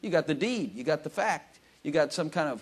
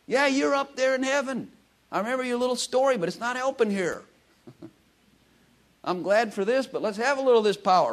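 higher, cheeky grumble up to heaven; mock complaint to Jesus; 9 semitones higher, 5 dB louder than usual. Preacher voicing an impatient prayer to Jesus, higher cheeky grumble. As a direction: nag Jesus half-jokingly.

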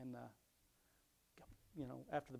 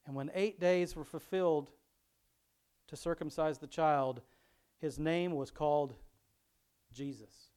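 louder, measured Bible reading voice; scripture reading; 2 semitones higher, 10 dB louder, slower than usual. Acted. Pastor reading Luke aloud to his congregation, louder reading voice. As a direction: read the passage out to the congregation.

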